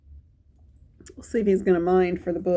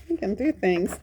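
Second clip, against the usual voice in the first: plain reading voice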